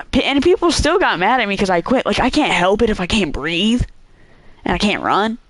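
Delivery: ordinary talk, nothing marked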